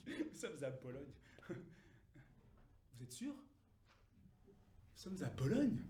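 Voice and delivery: very quiet, halting realisation; hushed, embarrassed dawning doubt; stunned disbelief. Acted. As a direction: say it small and slow, barely believing it. Dawning realisation of wrong town.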